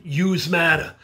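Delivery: Brooklyn accent, "Yous" said as one word, yooz, with a falling end. Shown as a demonstration. Speaker leads students in the Brooklyn version, Brooklyn accent. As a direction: say "Yous matter" like a New Yorker from Brooklyn. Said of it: "Say it with me. You're from Brooklyn".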